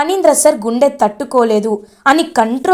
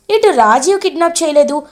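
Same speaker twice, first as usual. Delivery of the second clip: ordinary talk, nothing marked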